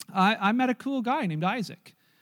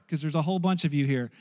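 normal talking voice

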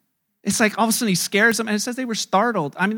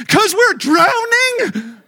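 much higher, louder, slow laughing panic; mock panicked wail; 9 semitones higher, 8 dB louder, much slower than usual. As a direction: whine it out half-laughing. Disciples answer in storm.

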